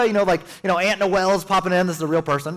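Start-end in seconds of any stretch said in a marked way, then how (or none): none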